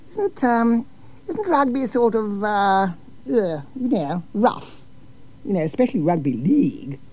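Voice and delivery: timidly voicing her doubt; nervous hostess. A narrator's reading.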